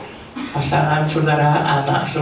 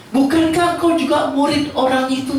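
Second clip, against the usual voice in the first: put-on accusing servant girl; 9 semitones higher than usual. Slave girl questions Peter.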